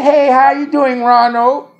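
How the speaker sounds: high, slow, drawn-out condescending greeting; sing-song tone. Retold or imitated. Imitating people addressing him like a child, mocking sing-song. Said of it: "that little sing-song tone of voice".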